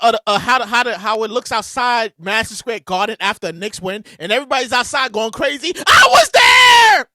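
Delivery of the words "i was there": loud, high, slow hyped insistence; excited emphatic declaration; hyped emphasis. Real, not acted. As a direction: hammer home how wild it was. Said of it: "emphatic recall".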